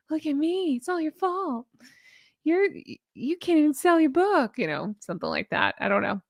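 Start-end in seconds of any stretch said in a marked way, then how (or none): 0.1-4.6 high, sharp mock accusation; blaming wife. Imagined wife blaming her husband, high mock scolding. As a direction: throw the blame at him in a put-on voice. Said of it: "I can just hear it like".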